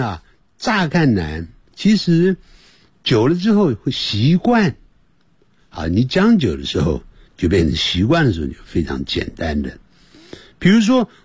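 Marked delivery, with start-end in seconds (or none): none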